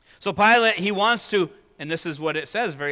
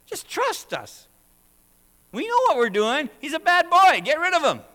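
mocking accusers; 13 semitones higher, 9 dB louder than usual. Accusers urge Pilate in a high mocking voice. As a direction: ham up the scheming elders.

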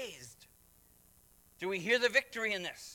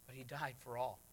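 much softer, lower repeat; hushed; 6 semitones lower, 11 dB quieter than usual. Acted. Preacher to congregation, hushed repeat. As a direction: drop to a near hush, "died" gently falling.

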